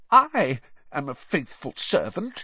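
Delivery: a hint of a wounded servant; eager servant. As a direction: insist on his loyalty. Servant protests loyalty.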